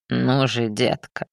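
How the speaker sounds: low, falsely tender coaxing; fire demon. Acted. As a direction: sweet-talk with hidden menace. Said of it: "Голос демона звучал ласково, даже задушевно".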